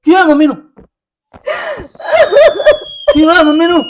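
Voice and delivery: hammed-up soap opera shock, repeated; melodramatic shock. Melodramatic reaction to mother's illness.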